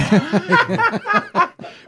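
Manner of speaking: high squealing laugh; high burst of laughter. Real, not acted. Laughing at push-ups joke.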